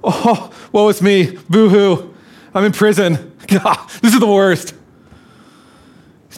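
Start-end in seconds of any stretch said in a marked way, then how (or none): 0.0-4.7 high, whiny woe-is-me wail, hammed up; mock self-pity. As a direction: play Paul as a pitiful complainer, boo-hoo. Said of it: "writing this letter ... and going".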